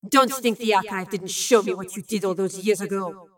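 mild menacing hiss. A narrator's reading. Lorelei accuses Bill over the past, understated hiss. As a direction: threaten with a touch of venom. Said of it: "Lorelei had hissed".

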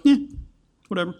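mildly mock indifference; indifferent shrug. Preacher imitating someone who hears the gospel and doesn't care, offhand indifference. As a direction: toss it off like someone who can't be bothered. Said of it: "Not care, just turn a blind eye".

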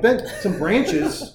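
high, slow incredulous echo; mock disbelief. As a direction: throw dad's words back at him, drawn out. Kid's incredulous retort to dad.

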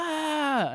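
high yelp while tumbling; mock cry of falling. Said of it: "you're like".

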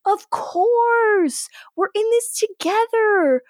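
high-pitched put-on paternal reassurance; hammed-up doting father, drawn out at the end; mock reassuring dad. Dad retold falsely reassuring son.

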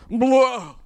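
loud mock outpouring noise; blurted "blah". Imitating someone unloading on a greeter, loud blurt. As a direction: blurt it out as one big blah. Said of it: "And they go".

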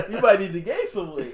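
loud, high, laughing voice; real amused laughter in the words; laughing joke. Real, not acted. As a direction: get it out through the laughter. Joking on the weight loss.

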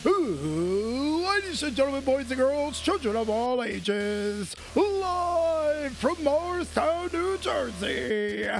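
drawn-out ringmaster announcement; slow booming circus announcer. Acted. Circus-style show introduction.

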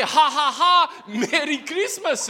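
put-on laughing Korean-accented Santa. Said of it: "Santa Claus had a thick Korean accent".

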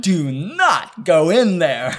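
slow, loud, high comic warning; over-the-top showman's warning; Ace Ventura. Acted. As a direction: punch each word theatrically. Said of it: "but it is Ace Ventura".

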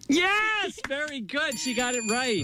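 high, exclaimed approval; excited praise. Acted. Host praising student guest's answer, excited high cheer.

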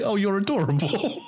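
high cooing adoration; high, fond coo. Retold or imitated. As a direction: coo it at something cute. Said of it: "It's like".

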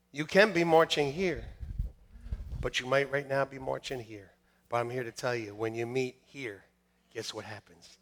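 low, soft, confiding; hushed build-up. Real, not acted. Preacher telling listeners what happens when they meet Jesus, hushed suspense. Preacher drops to hush before climax.

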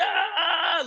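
high yelp of alarm; mock panic scream. Retelling his overwhelmed panic on Zoom, a high yelp. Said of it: "I was like".

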